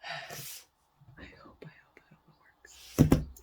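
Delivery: a soft, unvoiced whisper; whispered hope. Real, not acted. Hoping under her breath the sausage smuggling works, whispered. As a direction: breathe it like a prayer.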